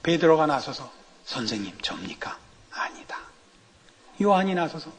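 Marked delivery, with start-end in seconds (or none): 1.2-3.2 hushed. Retold or imitated. Disciples ask Jesus, retold joke.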